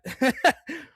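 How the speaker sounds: quick burst of laughter; short laugh. Real, not acted. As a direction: laugh it off suddenly. Caster cracks up at hiccups.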